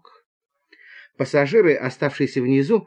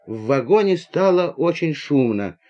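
ordinary delivery, nothing marked

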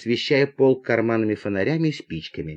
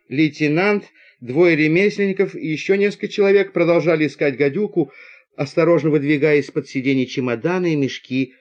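plain reading voice

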